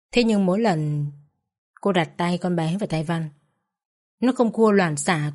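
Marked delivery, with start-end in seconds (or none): none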